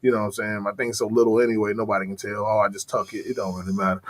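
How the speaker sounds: mock deep, low monotone replies; low-pitched dude-voice parody; Brittany Griner impression. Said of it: "that motherfucker's voice is just".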